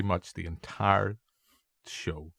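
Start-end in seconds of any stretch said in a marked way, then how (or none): none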